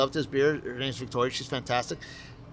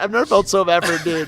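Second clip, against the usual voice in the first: usual speaking voice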